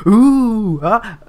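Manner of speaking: high, loud, drawn-out oohing; mock crowd awe. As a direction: imitate a crowd gawking at a spectacle. Acts out the waiting crowd.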